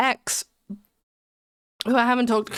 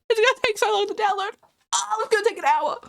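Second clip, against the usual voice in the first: rushed and higher; fast, high complaint; 10 semitones higher, faster than usual. Real, not acted. Streamer dismayed at the download time, high and fast. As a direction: blurt it out fast.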